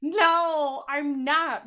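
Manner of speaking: high, loud mock protest; mock bashful protest. Imitating a viewer refusing praise, high loud mock protest. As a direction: squeal out a bashful denial. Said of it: "you're just sitting there like".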